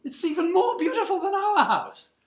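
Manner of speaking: high, loud, drawn-up exclamations; scandalized society neighbour. Acted. Society neighbours gossip about newcomers' bigger house, scandalized.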